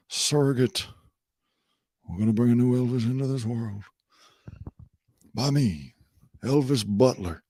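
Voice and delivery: drawling put-on Memphis accent, long pauses. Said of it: "that accent's gonna be coming out thick".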